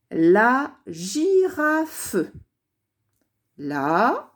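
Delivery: drawn-out, slowed dictation voice. Shown as a demonstration. Teacher dictating a word to pupils, slowed and drawn out. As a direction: stretch each syllable slowly for the class. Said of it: "première syllabe J, deuxième syllabe ra".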